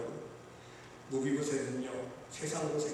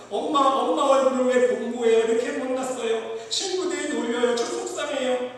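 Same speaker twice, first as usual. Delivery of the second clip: put-on upset child; 9 semitones higher, 10 dB louder than usual. Crying child complaining to mother.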